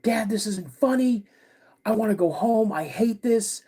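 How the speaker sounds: high-pitched, imitating an upset child; mock a scared kid pleading with Dad; retold hysterical screaming. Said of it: "I start screaming".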